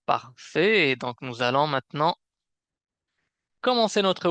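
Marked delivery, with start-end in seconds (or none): none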